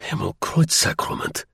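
startled German officer's curse; astonished exclamation. Acted. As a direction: exclaim it in amazement, without shouting. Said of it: "he exclaimed".

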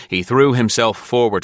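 ordinary delivery

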